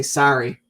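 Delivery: "sorry" with an open front vowel, like sari; American "sorry". Shown as a demonstration. Showing how [name] doesn't sing it, "sorry" said "sah-ree". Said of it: "He doesn't go".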